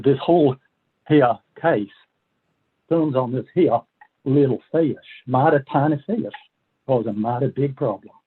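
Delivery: folksy southern lawyer imitating Griffin Bell, southern accent. Attorney General quoted arguing before the Supreme Court, mild southern accent. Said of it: "forgive my southern accent here".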